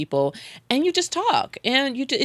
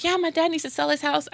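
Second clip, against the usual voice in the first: higher-pitched quote of a stranger; lightly voiced lady; 9 semitones higher than usual. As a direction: lightly imitate a woman answering, pitched up. Voices lady met at restaurant.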